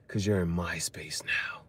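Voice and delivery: slow low whisper. Real, not acted. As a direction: breathe it slowly, close and secretive. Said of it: "whispered line".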